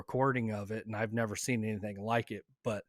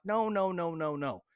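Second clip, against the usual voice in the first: retold higher-pitched protest, quickly waving someone off; mildly urgent objection, pitched up; insistent refusal; 6 semitones higher than usual. Hunter telling his brother to stay in the stand, insistent.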